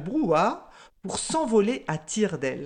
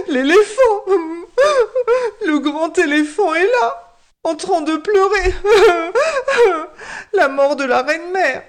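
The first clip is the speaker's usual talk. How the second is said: high, loud sobbing wail; whining tearful tattletale warthog; theatrical weeping; 10 semitones higher, 6 dB louder than usual. A narrator's reading. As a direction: blubber and bawl to the king, hammed-up. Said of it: "en pleurant davantage".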